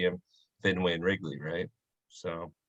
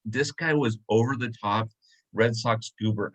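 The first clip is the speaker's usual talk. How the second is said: usual speaking voice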